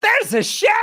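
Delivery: very high, loud shriek of disbelief; astonished. Retold or imitated. Passenger hears of a chef on board, astonished. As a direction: shriek the question in mock shock.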